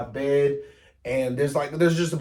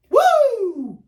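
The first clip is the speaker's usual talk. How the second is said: excited cheer; 7 dB louder than usual. Pretend. Self hype.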